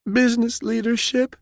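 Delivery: very high, sheepish answer; timid young woman's high voice; frightened young captain. A narrator's reading. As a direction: admit it meekly.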